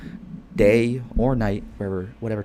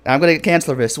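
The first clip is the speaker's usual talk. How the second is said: normal talking voice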